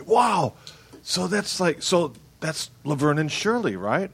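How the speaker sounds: high-pitched, eager mock amazement; wowed outsider. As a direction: imitate an awestruck know-it-all out-of-towner. Acts out people reacting to Milwaukee.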